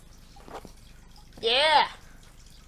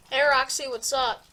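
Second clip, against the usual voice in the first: normal talking voice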